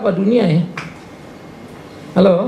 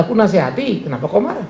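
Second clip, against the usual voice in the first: usual speaking voice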